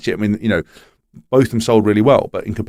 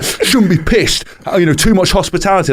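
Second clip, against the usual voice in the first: mock scolding; 8 semitones higher than usual. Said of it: "everyone's going completely mad".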